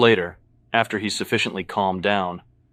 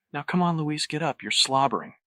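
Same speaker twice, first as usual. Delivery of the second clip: hushed coaxing; 7 semitones higher than usual. A narrator's reading. Patrick coaxes Luis off the floor, high hushed urging. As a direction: coax in a raised, hushed voice.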